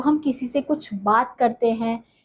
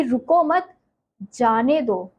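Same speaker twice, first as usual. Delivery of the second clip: raised रुको मत, clear break, then जाने दो dropping; pause after मत; slower than usual. Shown as a demonstration. Teacher asks pupils what it means, pausing after मत. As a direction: pitch up "रुको मत", halt, fall on "जाने दो". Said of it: "मत के बाद जो ठहराव हम लाए".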